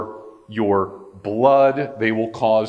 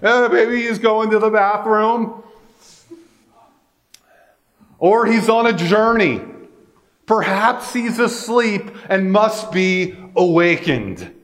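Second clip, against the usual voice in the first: higher, playful sneer; mocking; 8 semitones higher than usual. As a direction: tease them about their absent god. Elijah's mocking paraphrase.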